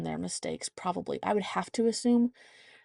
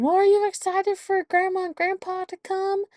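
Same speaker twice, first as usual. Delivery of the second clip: Pop Pop voice; 12 semitones higher, 5 dB louder than usual. Retold or imitated. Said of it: "Pop Pop had mentioned".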